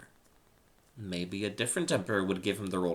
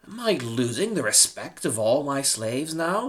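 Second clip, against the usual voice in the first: gasping indignation; 5 semitones higher, 9 dB louder than usual. Acted. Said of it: "Lysander gasped".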